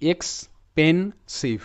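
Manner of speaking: Expensive stretched slowly, syllable by syllable; teacher sounding out expensive in slow parts; slow syllable breakdown. Shown as a demonstration. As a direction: pull expensive apart slowly. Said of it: "ভেঙে ভেঙে উচ্চারণটা এমন হবে".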